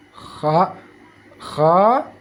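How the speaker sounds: "Kha, kha" with throaty rasp; long flowing KH in "Kha, kha"; vibrating kha. Shown as a demonstration. Teacher reviewing the letter, "Kha, kha" scraped and flowing. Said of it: "dia seperti ada getaran".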